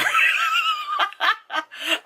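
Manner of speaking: real, high burst of laughter; big laugh. Real, not acted. Vlogger laughing at the actor who played Hitler, a high real laugh. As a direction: crack up at the joke.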